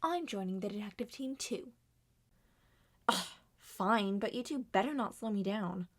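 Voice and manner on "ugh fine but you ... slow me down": usual speaking voice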